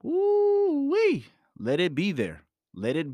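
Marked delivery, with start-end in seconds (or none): 0.0-1.2 excited whoop. Real, not acted. Exclaiming after letting it in.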